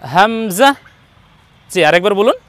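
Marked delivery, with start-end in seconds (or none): 0.0-0.7 হামজা ending in a buzzing "za"; buzzed z in the last syllable of হামজা; correct za ending. Shown as a demonstration. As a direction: say হামজা as "ham-za". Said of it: "জা বর্ণ লাগাবো".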